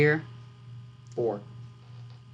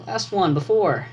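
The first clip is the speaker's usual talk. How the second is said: ordinary delivery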